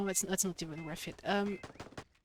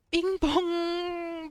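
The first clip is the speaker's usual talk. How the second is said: mock anguish; 8 semitones higher, 6 dB louder than usual. Mourning dead companion Bing Bong.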